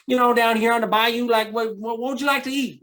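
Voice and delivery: high, loud put-on Southern accent; Southern twang. Bayou local asking a visitor what to eat, in a high Southern twang. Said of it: "that Southern twang".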